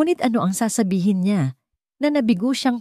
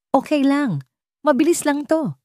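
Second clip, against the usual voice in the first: forced normal voice; 9 semitones higher than usual. A narrator's reading. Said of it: "Pinilit ni Mark na gawing normal ang kanyang boses".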